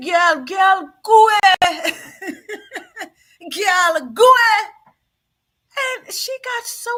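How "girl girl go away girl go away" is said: put-on loud, high girl go away, half laughing; mock shooing. Host retelling how she'd shoo the girl off, loud and laughing. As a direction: shoo her off with a hammed-up laugh.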